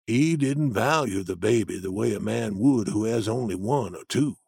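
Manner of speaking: low steady Jim arguing his point; Jim's voice. A narrator's reading. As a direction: voice the older man, grounded and sure. Jim rebuts.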